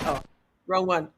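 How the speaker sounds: ordinary delivery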